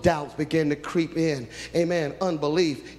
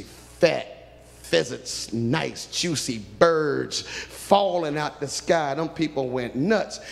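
ordinary delivery

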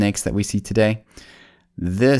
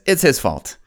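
a touch of Eve, higher and pointing the finger; lightly voiced Eve passing the blame; blaming Eve; 7 semitones higher than usual. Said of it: "Eve looks at the snake and says".

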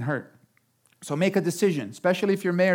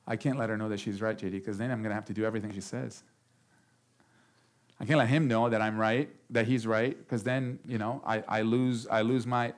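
low, soft worried muttering; insecure inner voice; 8 semitones lower, 9 dB quieter than usual. Acted. Insecure spouse reasoning privately, low and soft. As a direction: mumble it to yourself.

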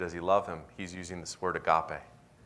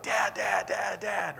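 put-on high excited child's call of Dad; toddler calling dad; 11 semitones higher, much slower than usual. Toddler running to dad at the door, high excited call. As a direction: call out like a little kid at the door. Toddler greeting returning father.

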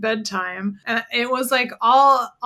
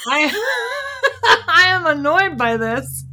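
high, laughing mock annoyance; laughing mock complaint; 8 semitones higher, 6 dB louder, slower than usual. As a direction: laugh through a fake gripe.

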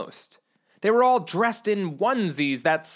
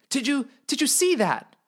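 startled; 8 semitones higher than usual. Acted. Startled by rustling trees.

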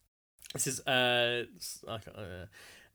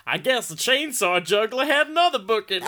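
high, loud American commenter; put-on American accent; 7 semitones higher, 5 dB louder than usual. Reading Cher from Jacksonville's comment, in a put-on American accent. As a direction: read it in a hammed-up American accent. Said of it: "Why is this all American?".

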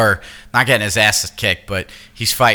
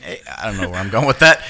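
ordinary talk, nothing marked

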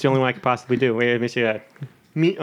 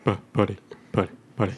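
putty impression; 8 semitones lower, 5 dB quieter than usual. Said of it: "Say putty".

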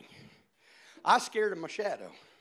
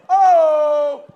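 loud, high hammed-up ouch; mock pain cry; 8 semitones higher, 20 dB louder than usual. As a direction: fake a yelp of pain. Mimicking hurting his hand.

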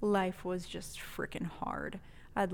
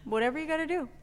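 breezy friend; 8 semitones higher, much faster than usual. Retold or imitated. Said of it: "She's like".

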